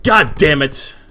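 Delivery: loud, high exclamation of frustration; angry outburst. Acted. Ludwig hits the desk after refusal, angry outburst. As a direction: curse loudly as if slamming a desk.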